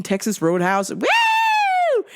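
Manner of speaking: imitated crowd cheer rising to a very high whoop; mock holler from a restaurant tape; mock screaming. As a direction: end on a squealed party whoop. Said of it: "them screaming".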